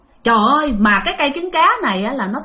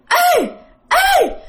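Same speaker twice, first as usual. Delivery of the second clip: very high, ringing cry; pig with girl's voice. Retold or imitated. Giant pig in the tree calls down, high girlish cry. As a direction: call out high as a demon pig. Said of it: "giọng đó là giọng con gái".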